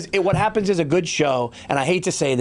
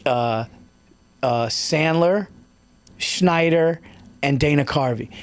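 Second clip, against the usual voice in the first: ordinary talk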